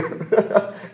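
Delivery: short, loud burst of laughter. Real, not acted. Preacher recalling the congregation roaring, real laugh. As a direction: break into a quick high laugh.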